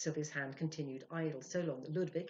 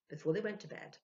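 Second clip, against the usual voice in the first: usual speaking voice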